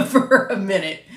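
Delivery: loud breathy chuckle into the words; laughing. Real, not acted. Narrator amused at needing to center herself, laughing. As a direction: laugh through the phrase. Laughing at own recording struggles.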